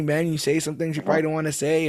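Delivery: ordinary delivery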